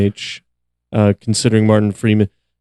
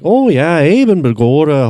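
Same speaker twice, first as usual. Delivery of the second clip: put-on overdone stage Irish accent, higher; 4 semitones higher than usual. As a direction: ham up a cartoon Irish accent. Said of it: "To not sound Irish".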